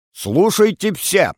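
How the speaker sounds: high, slow, grand proclamation; radio announcer. Acted. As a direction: announce it like a state radio herald. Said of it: "Голосом диктора".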